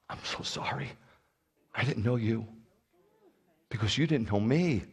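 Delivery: whispered, tearful sorrow; crying. Retold or imitated. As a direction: breathe the apology out through tears.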